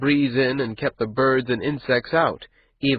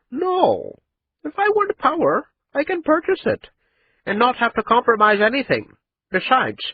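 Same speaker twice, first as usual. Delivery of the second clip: boastful excitement; 13 semitones higher than usual. A narrator's reading. Rich merchant boasting, voice soaring.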